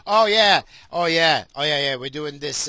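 lightly voiced corrupt moderator; mock bribed staffer. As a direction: sell it like a smug bought official. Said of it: "they're like".

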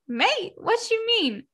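high, shocked question; reacting to the mate reveal, high and shocked; shocked disbelief. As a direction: squeak it in disbelief. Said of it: "And I said".

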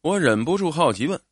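plain reading voice

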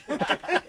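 high, loud burst of laughing; loud laughter. Real, not acted. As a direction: laugh hard at the memory. Laughing after the yell.

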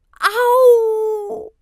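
loud, high, drawn-out wail; scream of pain. A narrator's reading. Ayoub hurt during the race, screaming in pain. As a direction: cry out hurt, holding the vowel. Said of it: "hoort hij een schreeuw".

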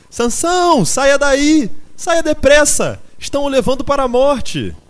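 very high, full-force screamed warning; desperate screaming. A narrator's reading. Quitéria warns Sansão of death, screaming desperately. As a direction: scream as if a life depends on it. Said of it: "berrou Quitéria desesperadamente".

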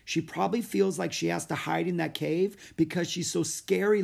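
put-on high eager girl's voice; bright young Annie; little girl voice. Annie's voice in story.

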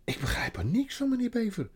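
breathless half-whisper; out of breath from the climb; panting Peter. Acted. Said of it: "hijgde Peter".

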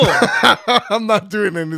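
laughing through the words; slow, laughing line. Real, not acted. Laughing repeat.

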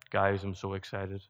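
low, subdued monotone imitation of an unexcited friend; blank-faced deadpan. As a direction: claim excitement with no expression at all. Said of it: "He just didn't have a lot of expression".

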